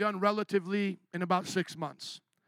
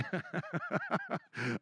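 a soft, sheepish chuckle; embarrassed laugh; 7 dB quieter than usual. Real, not acted. Preacher laughing at his own impatience, a soft chuckle. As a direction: laugh at yourself, caught out. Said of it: "I can't even say it without laughing".

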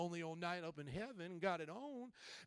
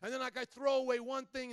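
usual speaking voice

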